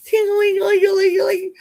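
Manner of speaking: high, fast sing-song ting-a-ling; playful high chiming imitation of a bell; bell noise. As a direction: ring it out like a little bell, loud and quick.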